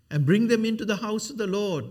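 playing up the made-up version of bring them into the house of the Lord; deliberately wrong quote. Preacher misquoting verse two to his congregation, loud and high. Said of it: "That's not what verse two says".

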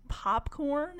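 normal talking voice

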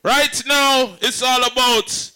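high, loud MC intro, slowed on the name; hype announcer. Real, not acted. MC introducing [name] to the crowd, loud hype. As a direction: build them up for the artist.

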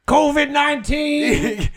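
slow, very high, loud preacher bellow; Kenneth Copeland impression. Mocking the televangelist, drawn-out preacher cry. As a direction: blow the words out like a TV preacher. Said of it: "You know Kenneth Copeland?".

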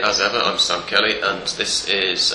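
ordinary delivery

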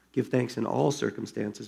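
gentle correction. A narrator's reading. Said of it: "Betsy corrected".

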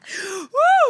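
high loud squealing laugh; shrieking laugh. Real, not acted. Laughing fit.

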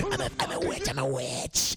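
creepy whispered witch voice; spooky witch. Retold or imitated. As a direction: hiss it like a witch casting a curse. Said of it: "she spooked him so much".

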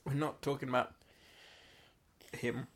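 usual speaking voice

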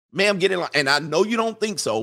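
normal talking voice

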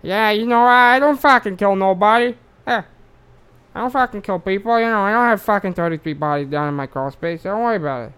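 loud New York tough guy, pushing to sound innocent; a lightly done New York accent that settles down by the end. Retold or imitated. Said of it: "I gotta just try and stay, like, New York accent".

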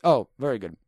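usual speaking voice

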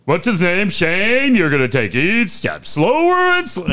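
playing the showman calling a guy down; a hint of a hypnotist. Hypnotist calling a man down from the balcony, mock stage voice. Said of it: "He's like".

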